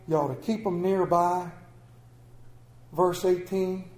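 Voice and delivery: ordinary talk, nothing marked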